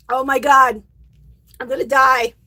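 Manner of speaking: strained, breathless after coughing; recovering from choking. Real, not acted. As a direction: gasp it out between coughs. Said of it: "I almost choked on my water".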